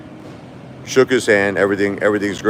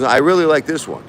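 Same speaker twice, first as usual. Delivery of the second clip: mock Trump approval; 8 semitones higher than usual.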